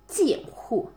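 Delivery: ordinary talk, nothing marked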